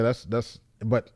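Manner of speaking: normal talking voice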